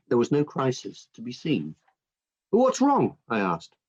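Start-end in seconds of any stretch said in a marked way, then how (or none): 2.5-3.2 high-pitched anxious question; alarmed question. A narrator's reading. Father rushes into son's bedroom fearing trouble, alarmed question. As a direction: ask in sudden alarm, pitch jumping up.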